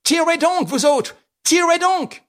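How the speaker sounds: high, loud commands to his men; shouting orders. Acted. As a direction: scream at them to fire. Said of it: "apostrophant ses hommes".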